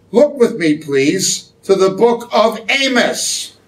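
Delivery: ordinary talk, nothing marked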